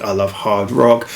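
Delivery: normal talking voice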